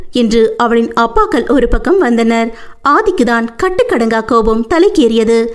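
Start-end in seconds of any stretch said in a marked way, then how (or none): none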